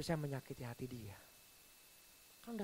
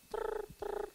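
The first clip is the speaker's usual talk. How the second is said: toy spin sound. Retold or imitated. Imitating a spinning toy.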